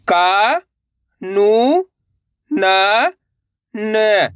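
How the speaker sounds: each syllable held long and separate, vowels swooping up, last one dropping. Shown as a demonstration. Teacher sounding out a word for learners, syllable by syllable. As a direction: spell it out sound by sound with pauses. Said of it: "एक अक्षर का उच्चारण करना है".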